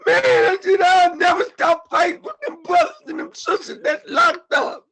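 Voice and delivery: high, loud crying shout; real anguished cry, loud and high. Real, not acted. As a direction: cry it out with full force. Vows to keep fighting, crying out.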